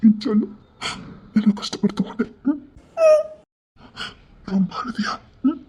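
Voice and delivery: tearful tenderness. Acted. Tender reunion with lover.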